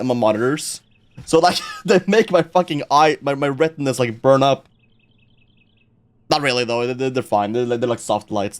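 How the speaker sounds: ordinary talk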